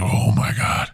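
breathless whispered gasp. Retold or imitated. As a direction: whisper it in shock. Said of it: "I was like".

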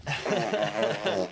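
high, loud laughing words. Real, not acted. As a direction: laugh through it, slow. Big laugh after the sneeze.

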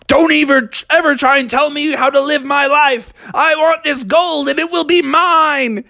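high, strained defiant rant; defiant greedy bear. Acted. Brother Bear refusing Father Frog, high defiant outburst. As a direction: snap back in a high voice. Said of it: "Brother Bear said".

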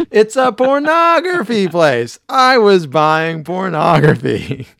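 high, loud, slow confession; panicked loud blurt. Retold or imitated. Homer blurting quote.

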